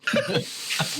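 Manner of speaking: high breathless laugh; a squeaky giggle. Real, not acted. Game master laughs at his own bit, high and breathless.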